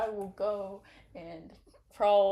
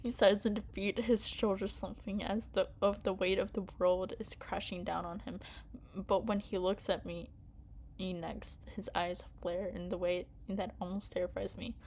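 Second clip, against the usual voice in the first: story narration; 5 dB quieter than usual. A narrator's reading. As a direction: read the scene quietly to yourself.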